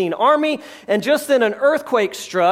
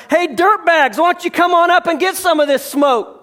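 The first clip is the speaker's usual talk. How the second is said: higher and a bit louder, jeering taunt; mock taunting yell; 7 semitones higher, 3 dB louder than usual. Philistines jeering down at Jonathan, mock taunt. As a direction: taunt them from the top of a cliff. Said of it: "the Philistines yell down".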